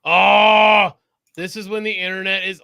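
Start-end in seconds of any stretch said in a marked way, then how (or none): 0.0-0.9 excited shout. Real, not acted. Delighted reaction to video.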